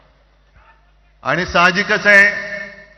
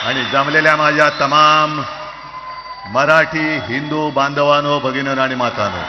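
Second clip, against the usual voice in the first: rally speech. Real, not acted. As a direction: call out to the gathered crowd. Opening address to rally crowd. (सभेतील जोरदार संबोधन)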